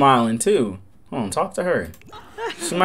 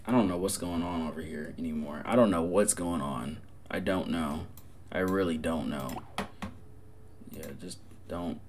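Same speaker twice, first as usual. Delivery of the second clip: much lower, soft, fast mutter; bewildered mutter; 12 semitones lower, 19 dB quieter, faster than usual. Real, not acted. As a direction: mumble to yourself, lost.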